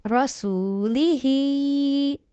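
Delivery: रसूली ही with ही held long; drawn out ही. Shown as a demonstration. Teacher showing students where to stretch the vowel. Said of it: "इसको हमने खींचना है".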